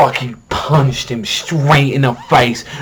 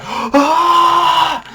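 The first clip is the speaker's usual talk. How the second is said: sudden high mock scream; imitated scream; 4 dB louder than usual. Vlogger reenacting the hero's scream, high mock yell. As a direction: let out a high yelp like the hero. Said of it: "when he was screaming".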